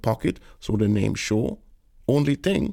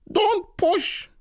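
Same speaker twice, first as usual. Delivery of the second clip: put-on indignant old aunt. A narrator's reading. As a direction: scold him like an elder. Tanti rebukes Harris.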